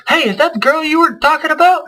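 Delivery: hammed-up nudge between buddies; mock gossip. YouTuber asking his friend about the fan, in a high teasing voice. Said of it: "they both look at each other and go".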